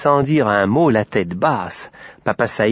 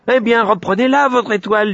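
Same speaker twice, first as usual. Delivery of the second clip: high, petulant little pig voice; sulky piglet; 12 semitones higher, 4 dB louder than usual. Acted. Piglet gives up the star, petulant. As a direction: give in with a squeaky huff.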